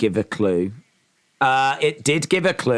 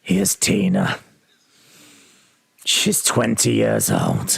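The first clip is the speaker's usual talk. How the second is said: lower, slow announcer introducing a contestant; pageant host voice; 6 semitones lower, slower than usual. Acted. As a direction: present the little girl like a beauty pageant host. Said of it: "I could do, uh, Little Miss Universe".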